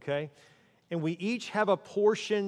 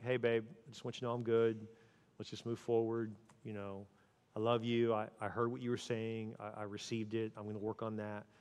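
soft, low and calm, fading quieter; gentle reassurance; 5 semitones lower, 6 dB quieter than usual. Pretend. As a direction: reassure your partner quietly. Quoting a soothing repair talk.

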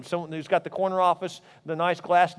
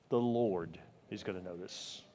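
hushed reverent; 10 semitones lower, 12 dB quieter than usual. Real, not acted. Solemn sermon climax.

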